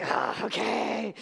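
long, dragged-out sighing okay; reluctant surrender. Retold or imitated. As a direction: give in with a slow, heavy groan. Retold reluctant giving in to God.

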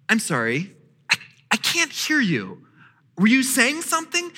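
a touch of playful feigned deafness; mock not hearing. Dad teasing his whining child, mild mock puzzlement. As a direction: tease the kid by pretending not to hear. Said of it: "I go".

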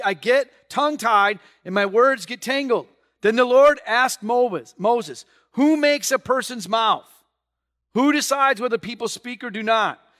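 loud, high dramatic reading; fervent scripture read; preaching scripture. Acted. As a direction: proclaim Moses and the Lord's words. Reading scripture with full force.

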